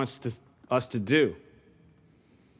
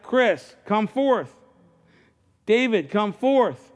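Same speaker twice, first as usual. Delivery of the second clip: ringing call; 8 semitones higher, slower than usual. Acted. God calling people by name.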